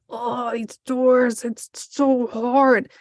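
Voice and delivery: slow, whiny mock struggle; mock straining whine. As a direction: drag it out like a weakling. Mocking the straining character.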